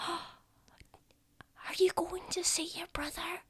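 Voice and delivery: tiny high, slow whisper; whispering little child. Acted. Child at the window asking the adventurer, high soft whisper. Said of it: "Oh, I'm whispering".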